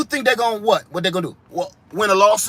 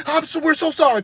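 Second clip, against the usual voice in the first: apologetic worker; 8 semitones higher than usual. Acted. Drive-thru worker apologizing.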